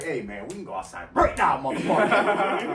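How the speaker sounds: mock-furious challenge, breaking into laughter; angry challenge. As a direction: re-enact calling him outside to fight. Acting out his quarrel with coworker.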